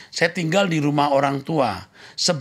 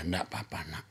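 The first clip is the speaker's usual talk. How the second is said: put-on hushed, much softer comfort to a child; soothing whisper; 7 semitones lower, 14 dB quieter than usual. Father comforting his bullied daughter in a soft whisper. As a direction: whisper it like calming a hurt daughter. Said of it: "saya mengajar anak saya".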